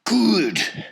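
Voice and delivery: ordinary delivery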